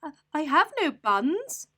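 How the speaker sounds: high, stammering little squirrel voice; shaking squirrel. Acted. Squirrel shivering before the rat, high stammer. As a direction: stammer it out in fright. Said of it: "the squirrel replied".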